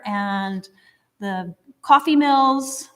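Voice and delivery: ordinary talk, nothing marked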